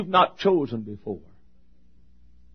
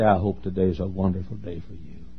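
sincere wish; 10 semitones lower, 8 dB quieter than usual. Shown as a demonstration. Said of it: "look 'em square in the eye and say".

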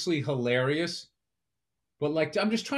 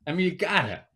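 ordinary delivery

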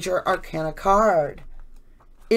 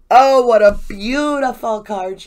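delighted gasp; 4 semitones higher, 9 dB louder than usual. Real, not acted. Admiring a drawn tarot card.

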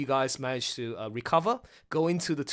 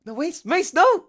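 panicked plea; 8 semitones higher, 7 dB louder than usual. Retold or imitated. Host imitating Tatiana pleading with Mace, building to a cry. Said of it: "Tatiana was saying".

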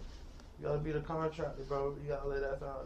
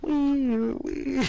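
high wailing police siren; siren noise; 8 semitones higher, 8 dB louder than usual. Pretend. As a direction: mimic a police siren wail. Imitating a siren.

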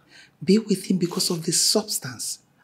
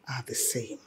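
dark whisper; 6 semitones lower, 13 dB quieter than usual. Acted. Mother drops voice darkly.

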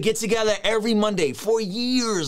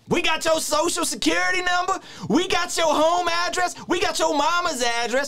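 mock preacher shout; 12 semitones higher than usual. Reactor mocking the pastor's witch threats, in a high hammed-up shout. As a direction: ham it up like a fire-and-brimstone preacher.

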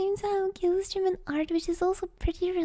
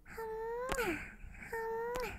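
high airy kiss noises; kiss sounds; 5 semitones higher, 10 dB quieter than usual. Acted. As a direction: blow soft kisses at the mic. Blowing kisses to chat.

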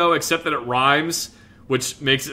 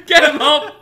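laughing, urging on; egging her on with a laugh; laughing cheer; 10 semitones higher, 6 dB louder than usual. Real, not acted.

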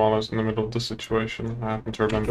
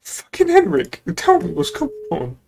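exasperated call; 12 semitones higher than usual. Real, not acted. Calling on Henrik to explain, high exasperated call. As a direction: drag the name out in despair.

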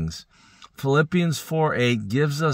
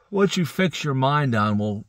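usual speaking voice

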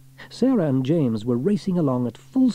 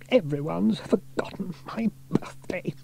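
muttering to himself; 7 semitones higher, 4 dB quieter than usual. A narrator's reading. Station Officer Steele sulking at the door, muttering. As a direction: grumble it under your breath. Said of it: "mutter as he opened".

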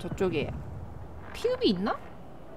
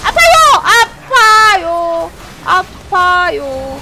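mock pained wail; 6 semitones higher, 8 dB louder, much slower than usual. Gamer whining in pain.

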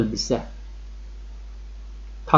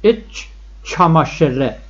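slow teaching demo; much slower than usual. Shown as a demonstration. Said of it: "لباس زیر".

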